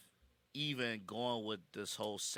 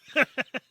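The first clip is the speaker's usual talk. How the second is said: short, high, loud laugh; real explosive laugh; 14 dB louder than usual. Real, not acted. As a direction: burst out laughing. Laughs at the bus news.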